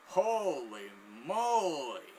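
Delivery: very slow, drawn-out marvelling; amazed. Retold or imitated. Chef reacting to the finished trick, amazed. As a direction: stretch both words in acted awe.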